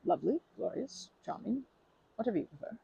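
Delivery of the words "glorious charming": usual speaking voice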